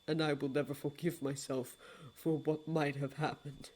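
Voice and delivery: low, soft, solemn regret; a low, hushed apology; grave lord of dreams. Acted. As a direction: speak like a deep and remorseful old god.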